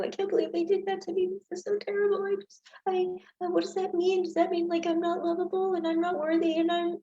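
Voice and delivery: high, fast, anxious whine; rushed, wounded inner monologue in a high voice; self-pitying spiral. Shown as a demonstration. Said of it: "you're just doing it to you".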